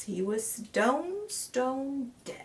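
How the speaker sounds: stone, stone dead stretched out slowly; slow emphasis. A narrator's reading. Killer examining the corpse, slow and deliberate. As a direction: lean on each stone, very slow.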